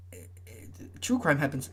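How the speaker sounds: ordinary delivery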